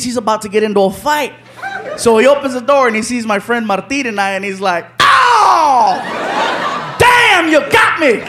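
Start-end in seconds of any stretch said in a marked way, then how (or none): 5.0-8.2 caught out. Retold or imitated. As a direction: shout it, laughing at being fooled. Man realizing the prank.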